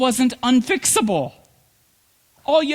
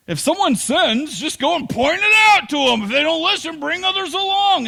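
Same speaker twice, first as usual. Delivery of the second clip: emphatic preaching; 7 semitones higher, 4 dB louder than usual. Real, not acted. Preaching at full force.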